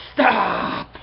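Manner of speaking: high loud snapped stop; sharp stop. Acted. The cat cutting off Atrocitus's whining, loud and high. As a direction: cut him off hard.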